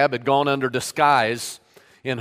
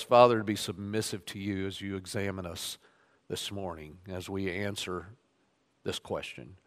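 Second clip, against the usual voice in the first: soft, low, hushed pastoral prayer; 3 semitones lower, 5 dB quieter than usual. Acted. Pastor praying before the sermon, softer and lower prayer voice. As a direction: pray quietly over the congregation.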